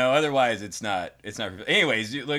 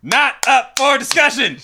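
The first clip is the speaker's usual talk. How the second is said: loud; 13 semitones higher, 10 dB louder than usual. Acted. Mock tough podcast banter.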